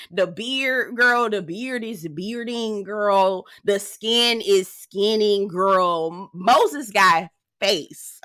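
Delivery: slow, savoring each word; slow admiring drawl. Pretend. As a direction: draw out the compliment. Slow drawn-out praise of looks.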